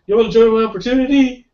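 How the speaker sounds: high, loud, fast mock pitch voice; cartoonish high hard-sell imitation; imitating Eli's sales voice. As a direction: ham up a squeaky, overeager salesman. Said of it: "his voice was like that".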